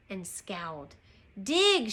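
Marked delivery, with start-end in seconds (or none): 1.4-1.9 raised-pitch captain's order; mild storybook pirate yell; shout. A narrator's reading. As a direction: shout it briefly at the crew. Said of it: "shouted Captain Bo".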